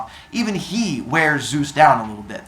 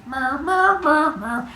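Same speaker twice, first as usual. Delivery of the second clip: high, sing-song mooing; cartoonish cow noises; imitated cow moos; 12 semitones higher, slower than usual. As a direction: mimic a herd lowing. Imitates cows coming out.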